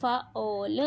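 "फ ऑल" in separate drawn-out sounds; phonics blending. Shown as a demonstration. Teacher leading pupil to read with phonics, sounded out. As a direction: sound out "फ", then "ऑल", no letter names. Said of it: "पढ़ना है फोनिक्स के साथ".

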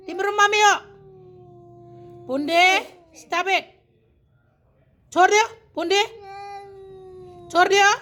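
loud, high calls to a dog; sharp scolding commands. Real, not acted. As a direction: call the angry pet off, loud and high. Calling out commands to angry dog. (malakas at mataas na pagsaway sa aso)

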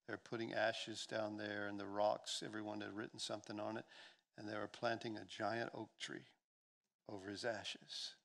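ordinary talk

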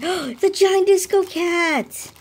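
high, drawn-out cry of amazement; excited reveal. Real, not acted. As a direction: gasp and announce it, thrilled. Reveals the giant plushie after gasp.

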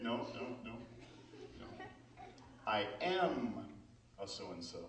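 ordinary delivery

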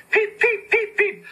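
very high piping whistle sounds; high piercing peep peep; engine whistle peeps. A narrator's reading. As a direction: toot like a train whistle. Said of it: "whistled Henry".

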